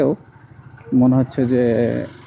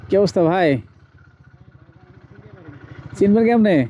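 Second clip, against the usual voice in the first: excited greeting; 7 semitones higher than usual. Real, not acted. Greeting friend on the street.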